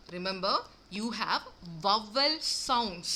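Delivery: vowel sounds, slow, each word spaced out; drawn-out vowel sounds; stress on sounds. Shown as a demonstration. Teacher stressing sounds for the class. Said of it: "vowels എന്നല്ല ഇനി പറയാൻ പോകുന്നത്, vowel sounds".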